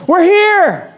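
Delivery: high-pitched, stretched-out yell; hollering. Retold or imitated. Runner yelling to the gate agent shutting the door, hollering. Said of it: "I holler out and I yell".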